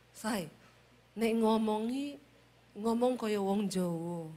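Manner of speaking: put-on soft, slow Javanese accent with drawn-out falling ends; Javanese husband. Husband asking his wife to talk like a Javanese, soft Javanese lilt. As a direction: plead gently in Solo Javanese style. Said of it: "tiap hari dituntut sama suami saya".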